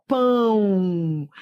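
"pão" held very long, ending in a U glide; som de U. Shown as a demonstration. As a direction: linger on "pão" to expose its final U. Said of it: "Esse O tem som de U". ("pão" bem esticado, com som de U no final)

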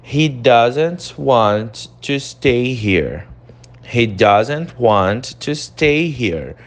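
slow, careful English model; teacher reading the example aloud; slow model sentence. Shown as a demonstration. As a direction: read it slowly twice.

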